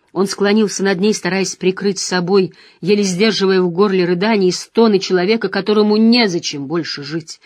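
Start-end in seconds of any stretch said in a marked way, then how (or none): none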